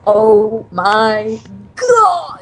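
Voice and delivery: very slow, drawn-out amazement; awestruck reaction. Retold or imitated. Fan reacting to a TV moment, hugely drawn-out awe. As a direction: stretch every word out in awe. Acting out her stunned reaction.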